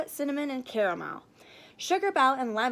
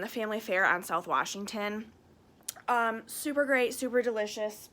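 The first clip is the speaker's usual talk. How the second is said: plain reading voice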